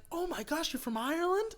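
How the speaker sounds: high, soft girlish mimicry; excited girl. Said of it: "And she's like".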